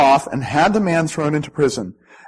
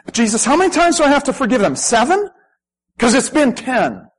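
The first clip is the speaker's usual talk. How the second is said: whiny complaint; 8 semitones higher than usual. Pretend. Mocking a grudge-holder's complaint.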